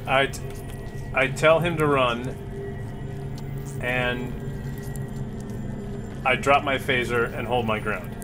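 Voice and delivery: ordinary delivery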